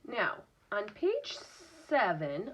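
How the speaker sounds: ordinary delivery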